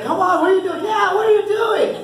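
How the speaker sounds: put-on high shouted scolding like a kid cheering; mock yell. Little mascot yelling at players in practice, high shout. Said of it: "She yells at them in practice".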